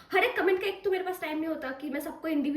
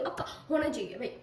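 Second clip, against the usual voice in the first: normal talking voice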